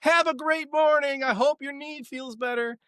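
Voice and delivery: high, stretched singsong yell, hammed up; imitated woman yelling. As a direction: call out like a woman yelling goodbye. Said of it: "the sound of a woman yelling".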